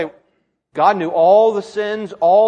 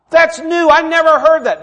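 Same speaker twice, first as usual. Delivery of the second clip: higher-pitched impressed listener; mock amazement; 10 semitones higher, 7 dB louder than usual. Congregation hearing something new, mock amazed. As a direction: act out being wowed by novelty.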